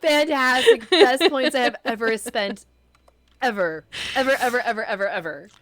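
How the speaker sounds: loud, gleeful raving; delighted. Real, not acted. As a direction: gush over it, thrilled. Delighted reaction to the song.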